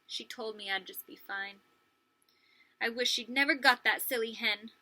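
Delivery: plain reading voice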